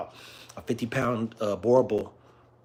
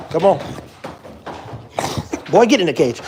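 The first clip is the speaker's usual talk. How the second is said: commanding the dog; 5 semitones higher, 7 dB louder than usual. Real, not acted.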